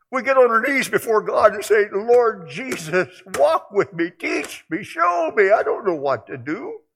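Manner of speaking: high, loud preaching plea; impassioned pulpit prayer; fervent pleading prayer. Acted. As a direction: beg the Lord with full force.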